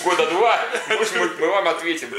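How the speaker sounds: high, chirpy hotline operator; recorded call-center greeting, mocking; customer service voice. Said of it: "звонишь, типа".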